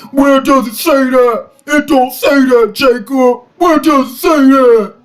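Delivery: high-pitched mocking protest; mock complaint in a high put-on voice; mock objecting Muslims. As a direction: imitate indignant objectors, pitched well up. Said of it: "'Cause the Muslims say".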